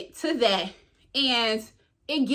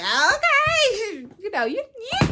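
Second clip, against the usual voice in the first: high, laughing mock-impressed okay; playful squeal; 10 semitones higher, much slower than usual. Woman reacting to the guy's exclusive drink, playful squeal.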